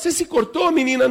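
alarmed mother. Acted. Alarmed mother questioning crying daughter.

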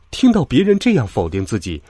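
ordinary delivery

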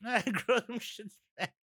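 laughing while repeating it; high, giggly repeat. Real, not acted. Laughing, repeating co-host's line.

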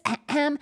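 exaggerated throat clearing; throat clear signal. Shown as a demonstration. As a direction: ahem as a classroom signal. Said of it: "It's going to sound like this".

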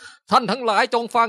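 mock a pompous speech to the masses; hammed-up preacher announcing; grand orator. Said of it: "เขาไม่มีแบบการแบบ".

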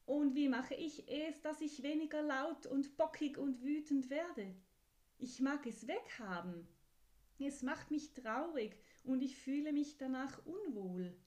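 put-on a child begging for help with its temper; upset little horse. Horse pleads about its anger.

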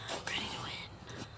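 hushed, slow whisper of a game boast. Pretend. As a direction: breathe it out as a secret threat.

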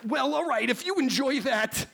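high, nasal-free nerdy professor; nerdy scientist voice. Acted. Plays Beekman.

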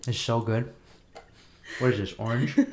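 talking while chewing candy; words round a mouthful of candy; mouth full. Real, not acted. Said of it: "it'll take me a while to chew it".

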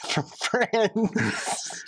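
laughed out, soft and breathless; amused giggly reply; laughing. Real, not acted. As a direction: giggle through the answer. Laughing at her confusion.

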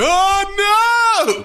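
high, loud hammed-up dismay; mock dramatic cry. A wrestler letting himself fall, crying out in mock dismay. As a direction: wail it like a hammy actor. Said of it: "I sort of like".

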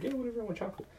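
put-on bratty kid. Kids brushing off the waiver.

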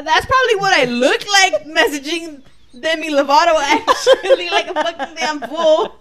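loud, high, laughing through the words; laughing self-mockery. Real, not acted. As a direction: crack up at your own foolishness. Cracking up at own Demi messaging.

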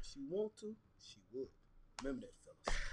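very soft, half-muttered "she would."; quiet aside. Real, not acted. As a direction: drop it low like a secret tip. Muttered advice aside to fellas.

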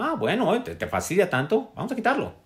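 high, gentle mock voice of God; kindly God. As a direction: lightly play God being kind and indulgent. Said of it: "Mi Dios amablemente me dijo".

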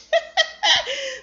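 short high laugh; real laugh. Real, not acted. Reader laughing after the chapter's last line.

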